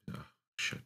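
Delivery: whispered under his breath; half-voiced frustrated mutter; muttered curse. Real, not acted. Mutters after losing his words. (leise geflüstert)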